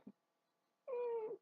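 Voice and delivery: thin, very high held whine; mock whimper, trying not to cry; holding back crying. Vlogger acting out losing a cat, high stifled whimper. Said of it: "like, trying not to cry".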